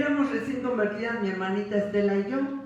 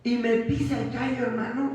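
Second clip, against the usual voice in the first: ordinary talk, nothing marked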